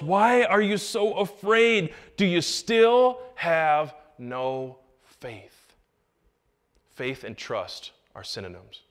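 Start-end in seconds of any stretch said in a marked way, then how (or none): 0.0-5.8 slow, deliberate gentle rebuke; Jesus rebuking. Retold or imitated. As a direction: draw out each word like a patient master. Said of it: "He said to his disciples".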